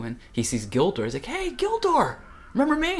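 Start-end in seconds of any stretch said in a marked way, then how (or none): 1.3-3.0 eager hailing. Retold or imitated. Dad spotting Gildor at church, high hailing.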